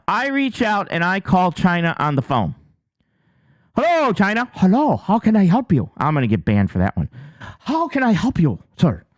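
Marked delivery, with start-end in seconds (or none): 3.7-5.9 loud, hammed-up Chinese accent; badly faked Chinese phone voice; mock Chinese accent. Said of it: "that's my best Chinese accent". 7.6-9.0 hammed-up Chinese accent on the phone; helpful rep in a put-on Chinese accent; mock Chinese accent. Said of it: "that's my best Chinese accent".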